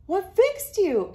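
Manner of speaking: high, loud, slow ask; curious question. Acted. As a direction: wonder out loud. Hank asks.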